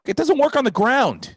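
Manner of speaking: high, loud exasperated shout; exasperated yell. Retold or imitated. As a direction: throw the line at her fast. Ranting line, then repeated.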